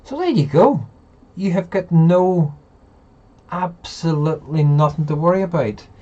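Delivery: excited reassurance. Real, not acted. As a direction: insist he has nothing to worry about, loud and high. Emphatic reassurance to a friend.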